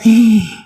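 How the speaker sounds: high, drawn-in "ah"; acted gasp at what was lost; dismayed gasp. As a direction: gasp as if looking back in dismay. Acts looking back on losses.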